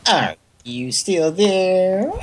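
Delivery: high, very slow needy prompt; clingy voicemail voice. Retold or imitated. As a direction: stretch it out like a clingy girlfriend. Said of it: "like a clingy girlfriend or something".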